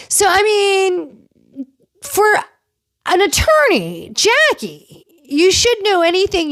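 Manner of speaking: mocking scold. Real, not acted. Mocking Jackie, the attorney.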